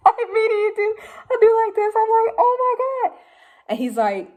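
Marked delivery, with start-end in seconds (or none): 0.1-3.2 high, loud starstruck squeal, acted out; losing it. Fan meeting Eminem face to face, losing it. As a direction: squeal through a mock meltdown. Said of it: "I'm, like, losing my shit".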